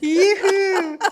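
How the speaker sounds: high, loud sing-song call; sing song yoo hoo. Acted. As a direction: call it out in a lilting tune. Playful call to the congregation.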